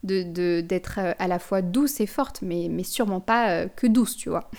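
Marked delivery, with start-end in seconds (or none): none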